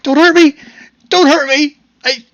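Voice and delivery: put-on high, loud frightened child's cry; terrified little boy. Frightened boy begging a dragonborn stranger, high terrified cry. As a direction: plead in a shrieking, panicked kid voice.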